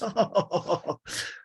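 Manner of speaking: high burst of laughter; falling run of ha-ha laughs; laughing. Retold or imitated. Host capping the DJ's boast, high laughter. Laughs after the acted punchline.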